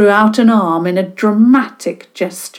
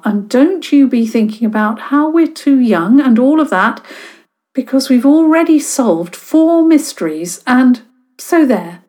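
indignant; 6 semitones higher, 5 dB louder than usual. Acted. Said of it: "Dora stepped forward indignantly".

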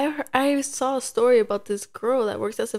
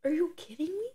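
higher, softer stunned whisper; 6 semitones higher, 6 dB quieter than usual. Real, not acted. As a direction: breathe "Are you kidding me?" in disbelief.

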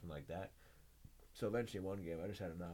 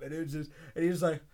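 ordinary talk, nothing marked

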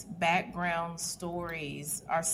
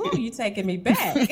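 bursting into laughter, louder; laughing; 7 semitones higher, 6 dB louder than usual. Real, not acted. Host laughing as she remembers caller ID. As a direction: laugh through the words, delighted.

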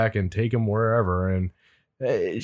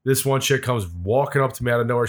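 usual speaking voice

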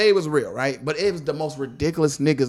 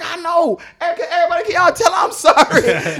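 high, loud, laughing plea; mock pleading shout; 5 dB louder than usual. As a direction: beg everyone to pass on his apology.

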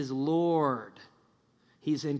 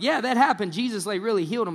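eager; 7 semitones higher, 7 dB louder, faster than usual. Acted. Crowd's reaction acted out.

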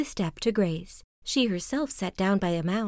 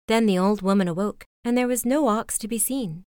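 ordinary delivery

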